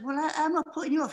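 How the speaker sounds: high, quick stammered question; retold flustered protest. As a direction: protest in squeaky bafflement. Flustered reply in retold quarrel.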